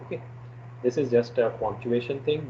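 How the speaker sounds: normal talking voice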